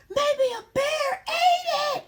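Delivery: very high, loud, slow crying shout. Acted. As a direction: wail it out in tears. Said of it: "he says".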